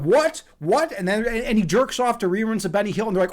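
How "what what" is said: imitated shocked question, pitched up; mock outraged disbelief. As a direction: fire it out like an offended tough kid. Retold bullies react with outrage.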